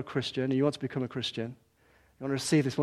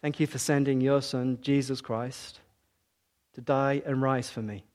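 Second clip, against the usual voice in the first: slow, spaced prayer with long pauses. Shown as a demonstration. Preacher leading the congregation line by line, slow and hushed. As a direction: pray it line by line, leaving gaps. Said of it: "I'm gonna say it slowly".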